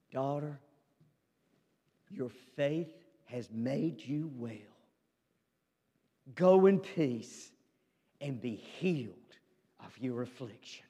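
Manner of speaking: hushed, tender words of release; tender Jesus voice. Acted. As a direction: lay it down softly, like a blessing. Jesus speaks tenderly to the woman.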